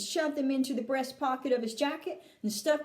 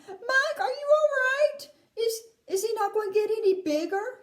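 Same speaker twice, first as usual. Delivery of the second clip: high, tearful-sounding father calling out; distressed shout; 10 semitones higher, 5 dB louder than usual. A narrator's reading. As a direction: shout it high in rising panic. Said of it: "shouted Mister T V".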